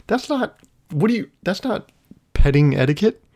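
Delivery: high, sputtering exasperation; exasperated disbelief. Retold or imitated. Man scolding a dog that screams while petted, exasperated.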